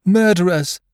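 high, loud accusing cry; shrieked accusation at the pirates. A narrator's reading. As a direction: cry out as an outraged young woman. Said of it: "she cried out".

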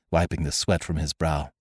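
plain reading voice

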